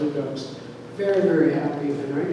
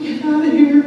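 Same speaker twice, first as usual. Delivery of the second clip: loud, high shout at a dog; shooing shout; 9 semitones higher, 9 dB louder than usual. Retold or imitated. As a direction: chase the dog off with a yell. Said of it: "I shooed".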